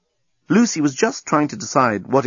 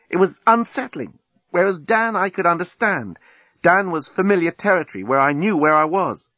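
a hint of a higher, frank woman's voice; Lucy, candid; 7 semitones higher than usual. Lucy confessing to Nettie on the beach, frank. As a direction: lay it out simply. Lucy explaining Nigel and Dan.

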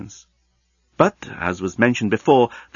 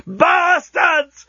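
very high, raging yell; drawn-out howl of outrage; furious yell; 4 dB louder than usual. A narrator's reading. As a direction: yell it at full pitch. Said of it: "he yelled at the unfinished works".

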